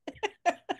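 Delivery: a quick high laugh; real laughter at a joke; high burst of laughter. Real, not acted.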